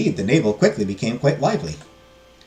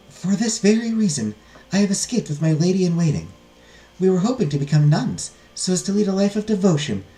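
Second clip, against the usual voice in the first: higher-pitched, earnest plea; hopeful heiress; 7 semitones higher than usual. Acted. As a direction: petition the abbess in a lifted, formal voice. Heiress pleads to join.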